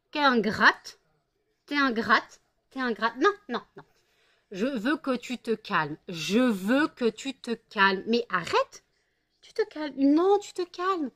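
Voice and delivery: scolding cat. Real, not acted. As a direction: scold the ungrateful cat firmly.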